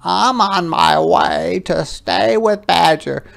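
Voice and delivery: a small creature deciding bravely; little hedgehog voice. Acted. Hedgehog offers his hat.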